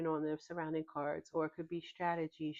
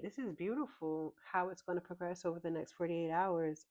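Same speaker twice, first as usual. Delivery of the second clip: normal talking voice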